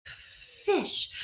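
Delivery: "Fish." whispered, with a long breathy f; whispered demo. Shown as a demonstration. Teacher whispering "Fish." so pupils feel the air. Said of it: "feel what happens when you say fish".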